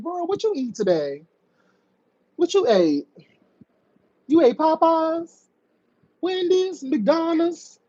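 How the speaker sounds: high, lilting pretend curiosity; mock-innocent questioning. Host making pretend small talk with angry caller, mock innocent.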